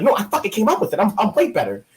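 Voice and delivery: indignant protest. Retold or imitated. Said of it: "I'm like".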